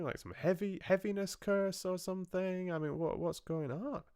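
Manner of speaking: high, sing-song puzzled questions; high, mock-innocent questioning; mock Rapunzel voice. Voicing Rapunzel questioning the witch.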